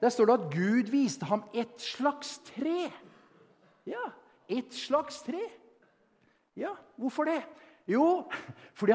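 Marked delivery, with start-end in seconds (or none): 0.0-5.4 slow and drawn out, "et slags tre" lingered on; savouring slags tre. Shown as a demonstration. Preacher relishing the Swedish wording to the church, drawn out. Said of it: "for det sa Stefan".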